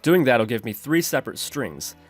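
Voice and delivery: ordinary delivery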